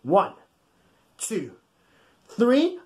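ordinary delivery

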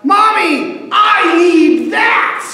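put-on high shrill little-boy shout; child yelling. Small boy shouting at his mom for a truck, high loud whine. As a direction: yell it like a toddler demanding a toy. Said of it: "One of the kids yelps out".

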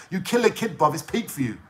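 normal talking voice

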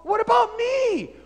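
high, loud cry of injustice; outraged shout. Acted. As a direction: yell it at Paul. Philemon argues with Paul.

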